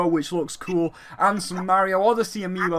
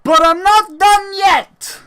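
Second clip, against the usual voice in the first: loud, high hyped showman voice; booming Reggie impression; 3 semitones higher, 5 dB louder than usual. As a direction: shout it like a hyped presenter. Imitating Reggie's announcement.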